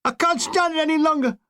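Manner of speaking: high, desperate scream. Retold or imitated. Shell-shocked young infantryman, high desperate scream. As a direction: break down in a scream. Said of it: "I suddenly heard a scream".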